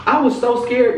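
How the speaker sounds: retold fright, pitched high; scared. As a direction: insist on the fear in a high voice. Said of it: "really scared".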